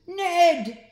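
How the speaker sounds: loud high yell of his name; furious scream. Acted. Sloth screaming at Ned in fury. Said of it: "she screamed".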